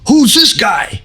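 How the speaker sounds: high-pitched, astonished exclamations; Steranko impression. Comic artist spotting a stranger at a signing, astonished high voice. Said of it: "Steranko turns and he looks at me and he's like".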